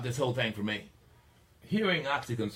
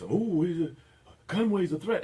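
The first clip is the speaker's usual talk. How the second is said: high, loud alarmed parody; mock talk-radio voice; 9 semitones higher, 6 dB louder than usual. Host parodying talk radio pundits, high loud mock alarm. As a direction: ham up the pundits' panic.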